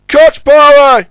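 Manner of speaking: high, loud, slow shout; crying out. A narrator's reading. The crane orders Ten Cents to cut power, shouting. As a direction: bellow the order. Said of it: "he cried".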